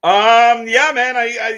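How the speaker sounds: laughing. Real, not acted. Laughing after voice-crack joke.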